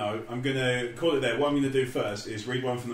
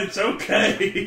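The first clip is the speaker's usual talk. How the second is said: slow mock soothing; 8 semitones higher, 4 dB louder, much slower than usual.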